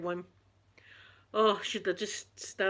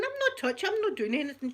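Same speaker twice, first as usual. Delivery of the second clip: retold protest; 7 semitones higher, faster than usual. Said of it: "I'm going".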